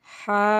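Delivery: হা from a tightened throat; squeezed-throat ha. Shown as a demonstration. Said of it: "গলা চিপে আমরা আওয়াজ করব". (গলা চিপে হা)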